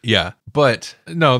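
ordinary delivery

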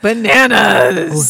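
slow grinning delivery of Bananas; smiling voice. Shown as a demonstration. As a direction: say Bananas with a big smile. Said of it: "should you smile when you say".

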